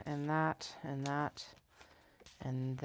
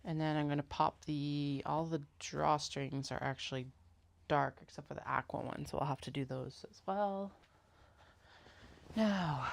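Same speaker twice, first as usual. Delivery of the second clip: ordinary delivery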